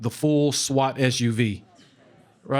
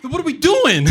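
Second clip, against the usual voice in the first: incredulous; 13 semitones higher, 4 dB louder than usual. Retold or imitated. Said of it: "laughs at his own line".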